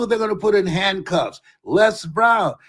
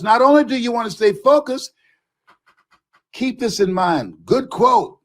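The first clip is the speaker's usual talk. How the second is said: usual speaking voice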